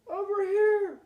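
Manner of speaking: high loud call from far off; a father calling back to his son. Acted. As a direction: answer back across the wood. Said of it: "the voice called out".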